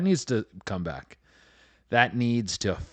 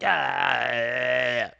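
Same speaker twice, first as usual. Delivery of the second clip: long drawn-out crazy wail; one sustained yell, going mock-crazy; crazed mock yell; 3 semitones higher than usual. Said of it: "going crazy".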